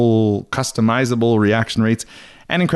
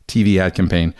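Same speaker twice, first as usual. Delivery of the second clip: ordinary talk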